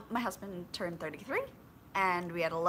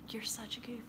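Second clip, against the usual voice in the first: whisper; 3 semitones higher, 9 dB quieter than usual. Real, not acted. Fond aside to dog.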